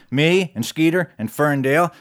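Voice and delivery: usual speaking voice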